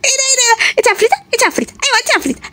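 put-on high child's taunt; teasing child. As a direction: tease like a cheeky kid. Child calling someone a devil.

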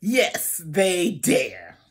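ordinary delivery

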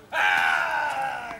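low, loud triumphant yell; victory shout. Real, not acted. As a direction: let out a winning roar. Grido di vittoria. (urlo di vittoria)

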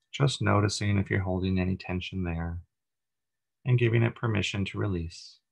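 even, unhurried meditation voice with long pauses; calm, steady body-scan narration; guided meditation. Acted. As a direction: guide listeners gently through breath and body, leaving space between phrases.

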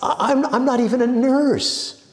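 high, loud, flustered protest; sputtering. A narrator's reading. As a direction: sputter it out helplessly. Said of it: "sputtered Ida".

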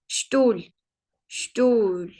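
"Stuhl" starting with sh, long u; German pronunciation demo. Shown as a demonstration. Teacher models S-T rule, "Stuhl" with sh onset. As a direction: say "Stuhl" as shtool, drawn out. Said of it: "S ve T harfi yan yana gelince de Ş".

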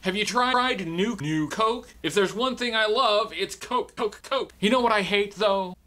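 high glitchy TV host; high, jerky stuttering TV-host voice; Max Hoodrum. Acted. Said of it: "Max Hoodrum here".

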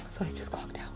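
hushed whispered aside; soft conspiratorial whisper. Real, not acted. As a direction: whisper it like a secret. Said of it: "unvoiced whisper".

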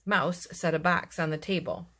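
ordinary delivery, nothing marked

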